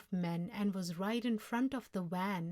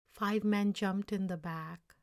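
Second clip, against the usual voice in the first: usual speaking voice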